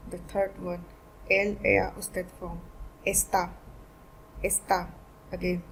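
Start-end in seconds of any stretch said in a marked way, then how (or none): 3.1-4.9 está stressed on the final á; "está" with a firm accented last vowel. Shown as a demonstration. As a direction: say "está" as es-TÁ, leaning on the end. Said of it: "the stress is on A".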